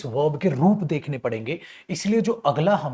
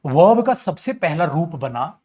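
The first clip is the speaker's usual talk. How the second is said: ordinary talk, nothing marked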